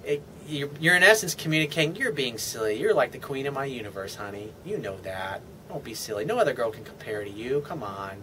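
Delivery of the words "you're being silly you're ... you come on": softer, low, teasing sweet talk; charming playful reassurance. Shown as a demonstration. Man calming his insecure girlfriend, soft playful sweet talk. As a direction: reassure her like she's the queen, gently. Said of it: "charming and sweet and playful".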